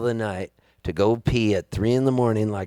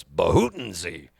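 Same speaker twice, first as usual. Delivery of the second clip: slow, low nonsense word; low dad voice; 8 semitones lower than usual. Retold or imitated. Dad's made-up cough word.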